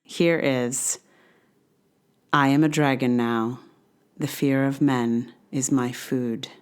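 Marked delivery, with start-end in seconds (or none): none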